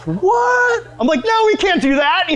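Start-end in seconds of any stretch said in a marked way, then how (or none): 0.1-0.9 very high, incredulous reaction; mock shock. Cautious friend hears the prank plan, shocked. 1.2-2.3 high-pitched, comic panic; alarmed protest. Retold or imitated. Cautious friend refusing the prankster's plan, alarmed.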